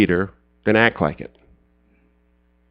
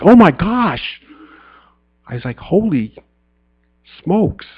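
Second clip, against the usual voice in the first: a higher gasp of disbelief at the tickets; stunned surprise; 4 semitones higher than usual. Retold or imitated. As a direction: rush it out as if he can't believe it. Discovering the tickets.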